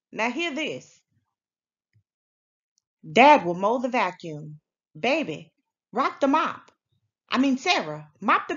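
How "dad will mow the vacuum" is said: plain reading voice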